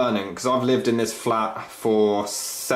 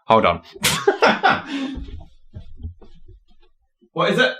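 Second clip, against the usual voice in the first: ordinary talk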